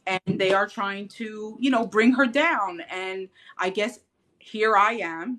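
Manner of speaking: ordinary delivery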